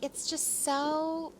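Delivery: slow, breathless-with-wonder documentary impression; mock a dreamy documentary expert, drawn out; documentary talking head. Host imitates history documentaries on stories of the past, slow mock wonder. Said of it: "these documentaries where they're like".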